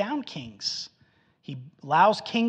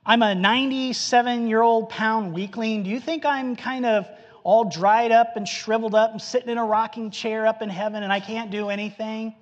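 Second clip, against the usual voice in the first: ordinary talk, nothing marked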